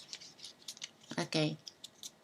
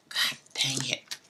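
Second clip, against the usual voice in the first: a slow whispered curse under the breath; whispered annoyance; 5 semitones lower, 6 dB quieter, slower than usual. Real, not acted. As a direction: hiss it to yourself, slow and breathless. Whispered frustration looking for dog.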